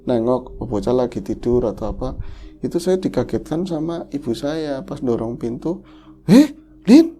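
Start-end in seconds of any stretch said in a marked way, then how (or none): 6.2-7.1 high, loud, alarmed mother's cry; worried mother. Retold or imitated. Mother bursting in on her crying daughter, high alarmed call. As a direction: call out in alarm like a startled mom.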